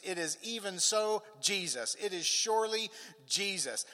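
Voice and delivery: emphatic, joyful preaching. Real, not acted. Preacher assuring the congregation of God's word, emphatic preaching. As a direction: proclaim it with punched key words.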